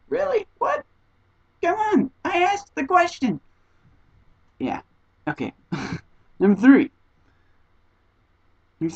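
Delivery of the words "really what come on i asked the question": very high, incredulous protest; hammed-up, high-pitched indignation; exasperated disbelief. Acts out her exasperated reaction.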